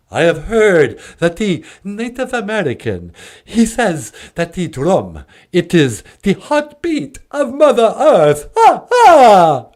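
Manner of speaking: solemn-to-gleeful vampire declaiming, rising to a cackle; grand, swooping Transylvanian puppet voice; Count Von Count. Acted. Count telling the host about the drum, theatrical vampire voice.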